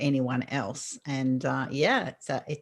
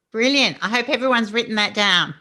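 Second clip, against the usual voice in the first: enthused praise; 7 semitones higher, 6 dB louder than usual. Real, not acted. Host enthused.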